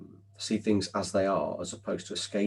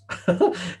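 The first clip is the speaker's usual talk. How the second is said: loud, high burst of laughter; real laugh; 11 semitones higher, 9 dB louder than usual. Real, not acted. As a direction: burst out laughing at your own joke. Laughs at his own joke.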